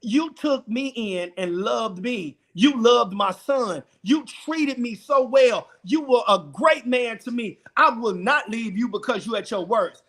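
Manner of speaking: soft, slow and sincere; tender reassurance. Retold or imitated. Wife answers her stricken husband, soft and tender. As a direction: reassure him gently, taking your time.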